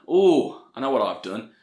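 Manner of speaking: normal talking voice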